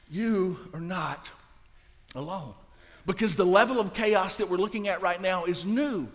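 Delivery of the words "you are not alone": slow, soft, sinking lower at the end; quiet refrain with a pause before the last word; gentle reassurance. Real, not acted. Preacher answering those who doubt God knows, slow quiet reassurance.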